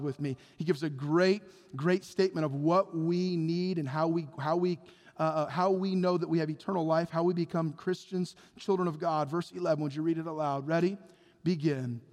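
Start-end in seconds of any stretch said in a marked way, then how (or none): none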